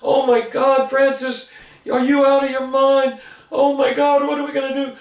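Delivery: teacher panicking at Francis; mock panicked scream. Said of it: "teachers just screaming at him".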